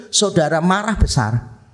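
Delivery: usual speaking voice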